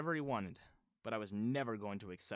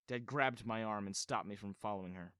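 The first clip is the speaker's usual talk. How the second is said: ordinary delivery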